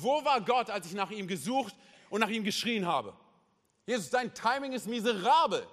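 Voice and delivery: high-pitched, heated protest; acted indignant reproach. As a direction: confront Jesus as the wronged, grieving widow. Acting widow's angry reproach to Jesus.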